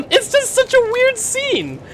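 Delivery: high, strained through laughter; laughing speech. Real, not acted. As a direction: barely get the words out laughing. Laughing at weird scene.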